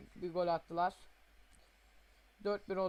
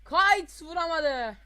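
loud slow drawn-out yell; commentator shout; 8 semitones higher, 12 dB louder, slower than usual. Pretend. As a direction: shout it like a missed chance. Commentator yells at shot.

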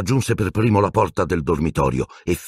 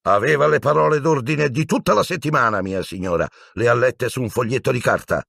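put-on proud old knight; 7 semitones higher than usual. Sir Cadogan proud.

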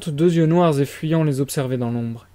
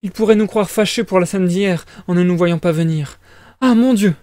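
worried mama fretting aloud; flustered mother, rising to a high exclamation; anxious mother character; 4 semitones higher, 4 dB louder than usual. Acted. Voices the mother.

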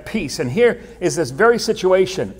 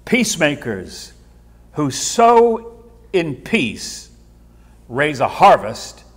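each phrase drawn out slowly; slow emphatic reading; slower than usual. Real, not acted. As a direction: lay every word down deliberately. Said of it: "Now watch this carefully".

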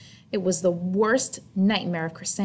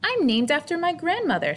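put-on proud little girl; 9 semitones higher than usual. Victoria boasting in story.

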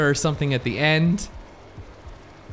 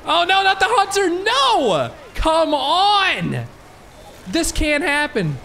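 panicked yell; 12 semitones higher, slower than usual. Real, not acted. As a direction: yell in panic as the hunter grabs you. Hunter grabs him, panicked.

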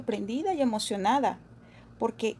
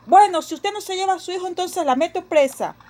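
loud, fast threat; threatening cop; 7 semitones higher, 6 dB louder than usual. Acted. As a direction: threaten to lock her up. Policía amenaza con arresto. (policía amenazante, fuerte y rápido)

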